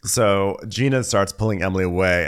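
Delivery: ordinary talk, nothing marked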